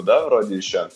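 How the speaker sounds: ordinary talk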